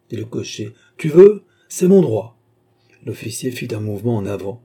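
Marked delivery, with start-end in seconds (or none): 1.0-2.4 loud, high, stubborn retort; defiant insistence. A narrator's reading. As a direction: stand your ground loudly. Coachman standing up to officer.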